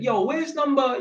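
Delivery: high-pitched retold hail; acting out asking a passer-by; calling out for directions. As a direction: call up to a stranger with a question.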